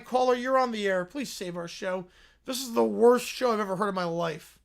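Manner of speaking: normal talking voice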